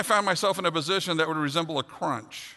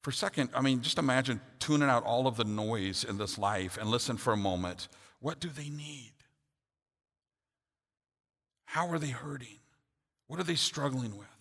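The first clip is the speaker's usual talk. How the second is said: soft, reflective preaching voice, much softer; hushed sermon; 7 semitones lower, 9 dB quieter than usual. Acted. Pastor inviting listeners to truly listen, softly reflective. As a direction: draw the congregation in gently and quietly.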